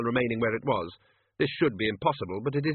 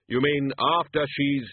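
slow, louder, grave; Death's voice; 4 semitones higher, 5 dB louder, slower than usual. Acted. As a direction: speak as Death, cut off. Death interrupting.

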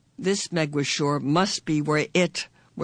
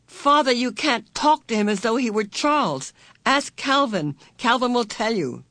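urgent pleading; 7 semitones higher than usual. A narrator's reading. Meg urgently pleads with father.

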